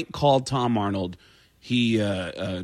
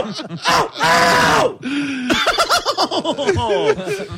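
much higher, screamed oh; Kinison scream; much slower than usual. Retold or imitated. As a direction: howl it out like Kinison. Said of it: "it's Sam Kinison".

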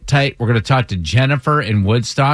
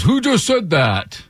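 retold dad's reaction; 7 semitones higher than usual. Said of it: "then Dad goes".

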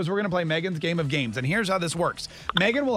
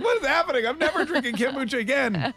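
mock panic; 7 semitones higher than usual. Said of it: "It's like".

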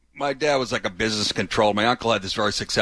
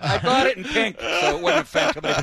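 mock high voice; 13 semitones higher than usual. Joke line, pitch jumps high.

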